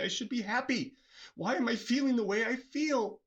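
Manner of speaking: a hint of distressed self-talk; mock anxious self. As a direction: play yourself fretting why you aren't happy. Voicing an anxious self-questioning mind.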